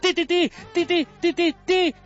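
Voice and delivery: high, loud stammered yell; mock stutterer trying to cry out; stuttering shout. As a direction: shout one syllable over and over, stuck. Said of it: "el capitán grita".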